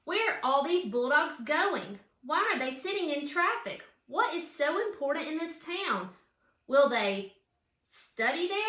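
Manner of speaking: lively read-aloud storybook voice; reading to children. A narrator's reading. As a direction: read it like a picture book for kids. Reads picture book aloud.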